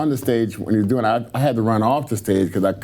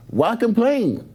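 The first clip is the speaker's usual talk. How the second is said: high-pitched mock punchline; Ronnie Coleman; 11 semitones higher, slower than usual. Bodybuilder ending his long speech, imitated high punchline. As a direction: deliver the moral in a high imitation.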